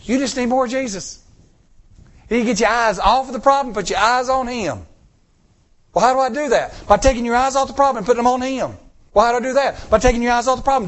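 high, loud, urgent preaching; fiery climax of a sermon; preaching at full force. Acted. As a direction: drive it home to the congregation.

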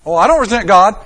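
loud, dismissive brush-off; defensive mock protest; mock denial. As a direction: brush it off too quickly. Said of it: "I want you to pray and say".

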